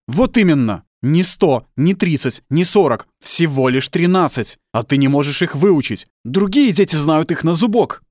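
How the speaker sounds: worked-up father lecturing his son; angry berating. Acted. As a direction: berate the child, hammering each point loudly. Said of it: "взвинчивается всё сильнее".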